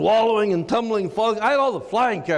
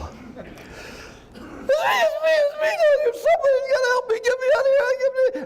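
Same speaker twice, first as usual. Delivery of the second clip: frantic cries, pitched way up; panicked high babble; slower than usual. Retold or imitated. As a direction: babble for help, voice cracking high. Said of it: "in my very finest airline pilot drawl".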